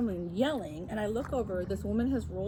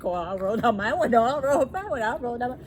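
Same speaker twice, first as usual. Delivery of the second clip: a light comic louder voice; comic self-quote; 3 semitones higher, 8 dB louder than usual. Retold or imitated. Narrator mimicking her own window comedy, lightly louder. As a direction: play the back-and-forth for laughs. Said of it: "like a comedy scene".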